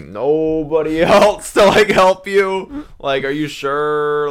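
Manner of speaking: high, drawn-out hesitant question; mock wary checking. Driver warily quizzing the stranded stranger, exaggerated hesitance. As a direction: stretch it out, voice climbing.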